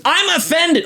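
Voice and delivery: high-pitched mock child voice; offended little kid. Imitating a six-year-old to his co-hosts, high and offended. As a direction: imitate an indignant small boy.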